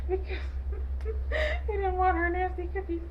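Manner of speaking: high, laughing through the words. Real, not acted. Recapping the cookie scene, real laughter, high. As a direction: crack up while you say it.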